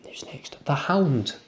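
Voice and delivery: hushed, slow teasing whisper. Acted. Teasing viewers with a whispered reveal of the next character.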